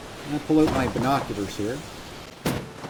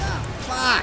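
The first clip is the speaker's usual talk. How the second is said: startled curse; 9 semitones higher than usual. Retold or imitated. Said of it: "I was like".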